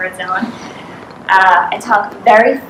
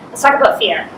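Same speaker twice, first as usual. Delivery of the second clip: ordinary talk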